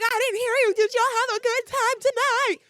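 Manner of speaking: very high, loud hype shout. Retold or imitated. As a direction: belt it out demanding a bigger cheer. Hype call repeated for louder answer.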